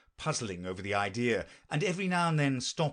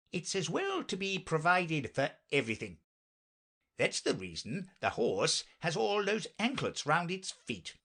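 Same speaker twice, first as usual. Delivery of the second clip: higher, lilting knight voice; earnest knight; 6 semitones higher than usual. Acted. Knight explains his horse's anklets, earnest and lilting. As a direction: explain it with swooping pitch.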